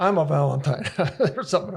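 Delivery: laughing. Real, not acted. Guest laughing at own nickname.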